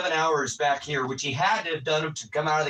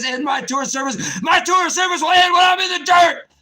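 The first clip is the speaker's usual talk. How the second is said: shouted much higher and louder, swelling to a roar; oath shouted; 11 semitones higher, 7 dB louder than usual. Acted. Veteran shouting his service oath on stream. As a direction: bellow the oath like a battle cry. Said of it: "It says".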